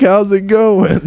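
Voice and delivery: high dopey stoner greeting; a spaced-out stoner saying hi. Pretend. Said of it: "they're just like".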